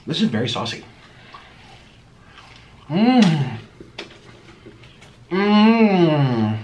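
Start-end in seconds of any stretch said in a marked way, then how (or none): 5.3-6.6 savouring mmm. Real, not acted. Savouring burger.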